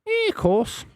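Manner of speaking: hammed-up Scouse shout, pitched very high; exaggerated mock Liverpool shout; loud Scouse accent. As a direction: belt it out in a silly high Scouse voice. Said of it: "Can you do a Scouse accent?".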